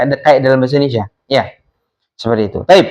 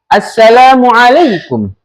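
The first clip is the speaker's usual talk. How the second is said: slow, higher-pitched teacher's model, falling at the end; model reading for repetition; 7 semitones higher, 4 dB louder than usual. Shown as a demonstration. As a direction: say it slowly for the class to copy. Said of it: "Ustaz akan bacakan, kalian tirukan lagi ya". (dibacakan pelan untuk ditirukan)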